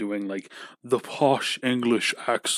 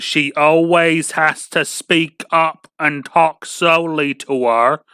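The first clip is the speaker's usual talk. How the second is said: slow, louder, spelled-out speech; slow loud talking; 5 semitones higher, 7 dB louder, much slower than usual. Retold or imitated. Mocking a customer who speaks to his mom slowly and loudly. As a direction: drag each word as if to someone slow. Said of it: "she thinks my mom's just kinda slow".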